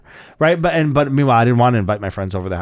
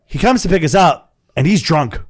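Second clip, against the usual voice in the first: ordinary talk, nothing marked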